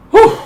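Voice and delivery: breathless whew after the workout; exhale. Real, not acted. Coach finishing a workout set, breathless whew. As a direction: blow out hard after the effort.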